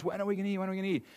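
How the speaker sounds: put-on high, soft, whiny child nagging; mock the kids pestering dad from the back seat; whining kids. Pastor imitating his hungry kids in the car, high whiny nag. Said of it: "my son and daughter".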